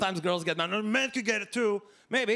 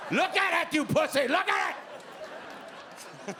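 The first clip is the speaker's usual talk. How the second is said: mock furious yell; 9 semitones higher than usual. Comedian yelling at his girlfriend over the phone, mock furious. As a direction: bark it at her in fake fury.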